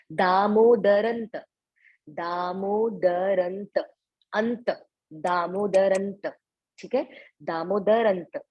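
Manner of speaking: दामोदरंत said slowly, syllable by syllable, the final त clear. Shown as a demonstration. Teacher drilling one word for the class. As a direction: stretch out दामोदरंत evenly. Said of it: "ऐसे एक शब्द को बोला जाएगा".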